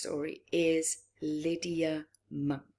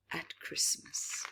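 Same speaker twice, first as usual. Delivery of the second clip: whisper; 2 semitones lower, 8 dB quieter than usual. Acted. Spooky whispered book title.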